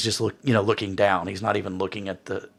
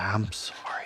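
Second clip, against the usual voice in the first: soft, voiceless sorry; whispered apology; 7 dB quieter than usual. Acted. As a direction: breathe the apology into a hug. Father murmurs apology during hug.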